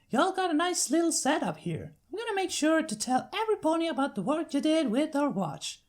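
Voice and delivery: friendly big-sister country voice; Applejack's country accent. Acted. Applejack sending her sister to the farm, Southern accent.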